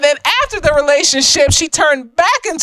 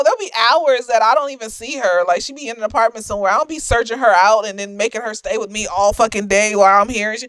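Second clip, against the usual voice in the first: ordinary talk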